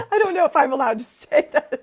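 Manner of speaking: high laughing talk. Real, not acted. Singer laughing after telling the party joke. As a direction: laugh through the words.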